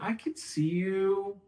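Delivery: slow, deliberate "I can see you"; slow down and leave them time to think; slow offer. Shown as a demonstration. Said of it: "you have to talk slowly to give them time to think".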